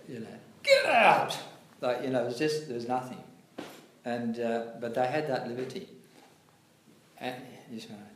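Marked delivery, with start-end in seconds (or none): 0.6-1.4 high, loud mock scream; screamed order. Guru screaming at the disciple, loud laughing yell. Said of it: "he literally screamed at me". 7.7-8.1 meek reply. Retold or imitated.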